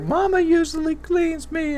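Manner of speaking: put-on very high, slow, tiny child voice; mother playing the child, in a baby voice; imitating little kid. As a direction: squeak it out like a toddler. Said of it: "and she's like".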